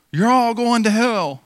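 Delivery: mock street preacher. Street-corner evangelist condemning passersby.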